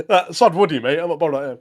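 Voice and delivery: ordinary delivery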